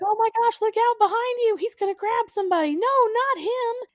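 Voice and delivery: high-pitched hammed-up panic; mock shrieking alarm. Screen actors warn audience about monster, mock shrieked alarm. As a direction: warn them in put-on monster-movie terror.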